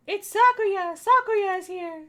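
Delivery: high, loud, gleeful cartoon voice; excited character voice. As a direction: burst in like an overjoyed sidekick. Voicing cheerful game character.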